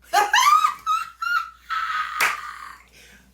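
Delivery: very high, loud cackle; shrieking laugh. Real, not acted. At her own plan of revenge, a wild high cackle.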